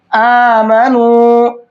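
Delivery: आमनू with the final vowel stretched one alif long; long madd. Shown as a demonstration. Teacher shows the stretched madd in आमनू. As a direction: hold the ू of आमनू. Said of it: "वाव मद्दा ... खींच करके पढ़ते हैं".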